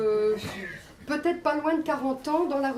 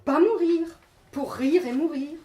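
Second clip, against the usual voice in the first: emphatic, rhythmic poetry performance in short phrases; poem recital; 3 semitones higher, 8 dB louder than usual. Acted. Poet reads her self-portrait to the audience, emphatic recital. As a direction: declaim it phrase by phrase, punching key words.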